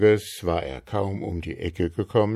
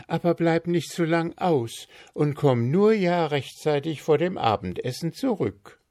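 motherly reminder; 9 semitones higher than usual. A narrator's reading. Frau Marker instructs.

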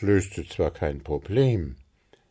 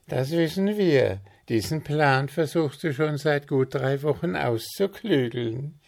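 amused smirk; 8 semitones higher, 3 dB louder than usual. Acted. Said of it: "schmunzelte Willi".

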